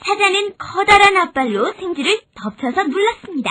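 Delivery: usual speaking voice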